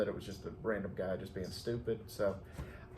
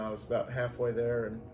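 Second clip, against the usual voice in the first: usual speaking voice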